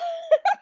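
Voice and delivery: laughing. Real, not acted. Laughing again.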